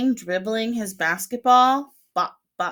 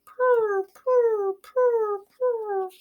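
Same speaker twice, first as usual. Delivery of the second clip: pigeon coo; 14 semitones higher, much slower than usual. Acted. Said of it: "they say".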